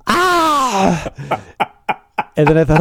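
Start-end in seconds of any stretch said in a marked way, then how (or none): 0.1-1.1 high yelp of panic; panicked yelp. Retold or imitated. Caller yelps in panic at his mistake. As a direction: let out a sudden high yelp. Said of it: "after I went".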